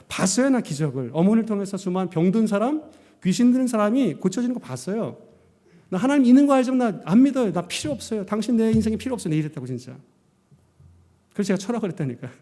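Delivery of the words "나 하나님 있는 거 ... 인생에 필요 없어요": high, loud start, then rushed dismissal; reenacted indignant protest, pitched up; defiant rejection. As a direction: act out pushing God away. Acts out rejecting God again.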